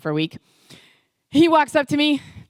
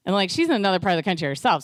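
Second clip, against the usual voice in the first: normal talking voice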